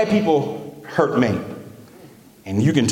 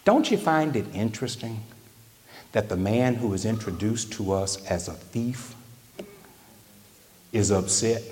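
ordinary talk

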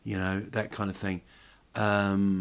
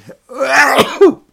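big explosive sneeze; loud sneeze; 17 dB louder than usual. Real, not acted. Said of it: "That was a full-on sneeze".

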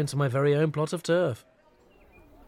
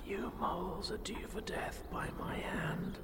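slow, menacing whisper; whispered threat; 6 semitones higher, 13 dB quieter, slower than usual. Acted. Narrator crouched by a plastic glass threatening moles, whispered. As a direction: whisper a death threat, slowly and very softly. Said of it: "crouched down beside it and whispered".

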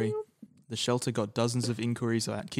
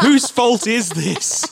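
higher, loud, slow accusing voice; booming mock interrogator; 12 semitones higher, 10 dB louder, much slower than usual. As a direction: boom it like a stern judge.

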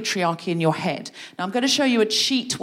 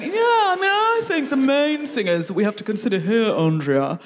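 mansplaining man. Retold or imitated. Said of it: "They're just opening their mouth and going".

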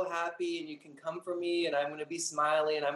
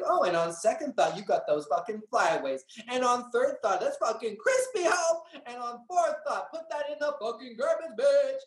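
retold sassy read; 9 semitones higher than usual. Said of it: "And I was like".

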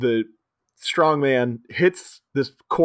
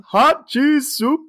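high, loud, slow "hot cheese soup"; soup carrier calling out; 12 semitones higher, 5 dB louder, much slower than usual. Shown as a demonstration. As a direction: stretch it out like a vendor's call. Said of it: "I love just saying".

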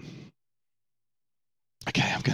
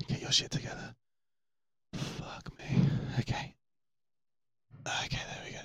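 tense whisper; 11 dB quieter than usual. Real, not acted. Steadying himself, tense moment.

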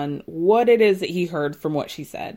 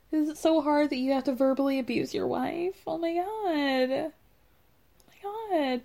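soft, high-pitched fake sympathy; mock pity; 6 semitones higher, 4 dB quieter than usual. Podcaster mocks the crying husband's self-pity, sarcastic fake sympathy.

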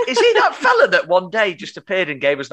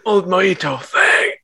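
Pierce Brosnan voice; slower than usual. Pretend. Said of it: "in your best Pierce Brosnan voice".

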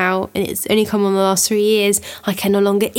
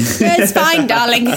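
sweetly sinister wife; 3 semitones higher, 4 dB louder, slower than usual. Pretend. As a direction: reassure him while choosing the knife. Playing menacing wife with knife.

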